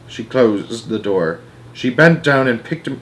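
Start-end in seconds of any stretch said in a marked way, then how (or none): none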